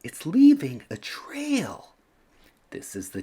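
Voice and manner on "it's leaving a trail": high whispered amazement; children murmuring about the trail; excited child whisper. A narrator's reading. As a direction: whisper it with wide-eyed wonder. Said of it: "say the children".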